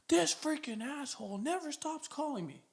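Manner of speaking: high, hushed imitation of an annoyed prospect; Ronnie. Recruiter imitating the prospect who keeps getting calls, mock-annoyed. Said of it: "you wanna know what Ronnie's doing".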